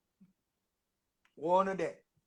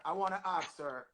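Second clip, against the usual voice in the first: softer standard-English "wanna ask her"; careful standard English; 6 dB quieter than usual. Real, not acted. Rephrases patois into standard English.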